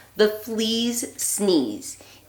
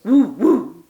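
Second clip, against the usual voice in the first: loud dog bark; a daunted dog barking; 3 semitones higher, 9 dB louder than usual. Acted. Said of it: "who barked".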